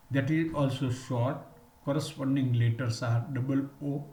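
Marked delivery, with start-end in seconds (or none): none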